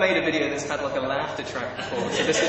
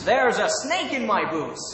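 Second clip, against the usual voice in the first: higher, put-on American cowboy accent; 4 semitones higher than usual. Shown as a demonstration. Brit trying toy cowboy's line, American accent. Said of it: "my best American accent".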